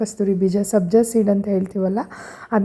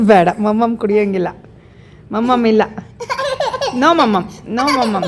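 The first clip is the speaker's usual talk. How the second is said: laughing refusal; 2 semitones higher, 3 dB louder than usual. Real, not acted. Playfully refusing the toddler.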